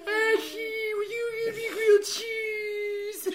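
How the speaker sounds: high, slow, wailing plea; whiny crying voice. Acted. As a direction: wail it like a crying child.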